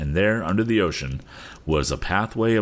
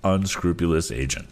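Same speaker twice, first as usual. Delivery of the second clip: ordinary delivery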